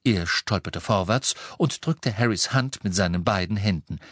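plain reading voice

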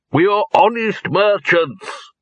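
high, slow and emphatic proclamation; loud declaration. A narrator's reading. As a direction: announce it for all around to hear. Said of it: "he repeated loudly".

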